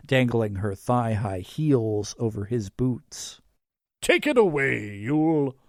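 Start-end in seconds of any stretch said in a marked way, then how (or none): 4.0-5.6 higher, theatrical Santa cue; Santa act. A narrator's reading. Santa cues Yule's demonstration, theatrical. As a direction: hand over with a showman's call. Nicholas hands over as Santa.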